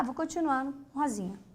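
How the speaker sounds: usual speaking voice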